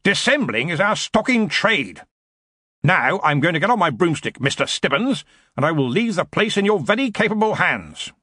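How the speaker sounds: mildly booming Arch-Chancellor. A narrator's reading. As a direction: hold forth to a junior with bluster. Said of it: "he boomed at Stibbons".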